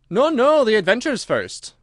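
loud, high, insistent objection; impatient. A narrator's reading. Gryphon overrules the Mock Turtle, loud and impatient. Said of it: "in an impatient tone".

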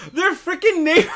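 real high-pitched laughing disbelief; shocked laughter. Real, not acted. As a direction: blurt it out and crack up. Shocked, amused reaction to a reveal.

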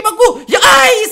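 high, loud yelp of alarm; startled high-pitched yell; panicked shout. Real, not acted. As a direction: cry out as the monster grabs you. Monster catches the player.

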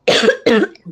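another loud hacking cough. Real, not acted. Livestreamer coughing again before apologising, loud cough. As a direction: cough hard, then recover. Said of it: "Sorry ha".

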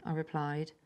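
plain reading voice

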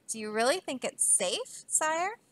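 soft, hesitant, rising on sire; worried question. A narrator's reading. Bartholomew doubting the king's plan, worried and hushed. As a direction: timidly check that it's safe.

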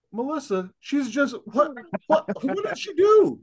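high-pitched, laughing exasperation; exasperated outcry. Real, not acted. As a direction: climb to a squeal in disbelief for her. Outraged on a character's behalf.

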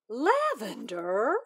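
can't believe her name was read; disbelief. Acted. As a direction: stretch it out in shock. Shocked disbelief.

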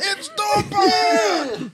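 very high, loud, stretched-out goon yell; henchman's yell. Acted. Game henchman spotting Batman, high drawn-out mock yell. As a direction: drag the last word into a screech. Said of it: "henchman in an Arkham game who yells".

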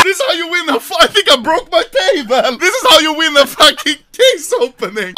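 high, loud crowing through laughter; overjoyed winner's gloat; triumphant yelling. Real, not acted. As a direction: yell your victory, breaking into laughs. Gloating after winning knife.

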